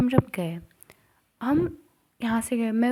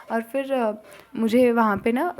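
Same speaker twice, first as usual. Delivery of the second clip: normal talking voice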